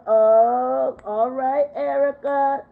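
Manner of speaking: ordinary talk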